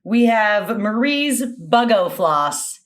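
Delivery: ordinary talk, nothing marked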